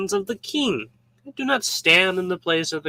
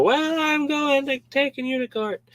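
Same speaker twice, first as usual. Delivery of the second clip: high, mock indignant threat; a hasty neighbor huffily threatening court; huffy complainer; 10 semitones higher than usual. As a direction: play the hothead who sues. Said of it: "And you go".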